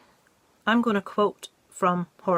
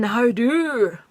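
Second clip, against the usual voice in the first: bad Yorkshire accent, louder and higher; hammed-up Yorkshire greeting; awful Sean Bean Yorkshire; 4 semitones higher, 7 dB louder than usual. Imitating a Yorkshire actor's catchphrase for viewers, bad Yorkshire accent. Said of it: "That's an awful Yorkshire accent".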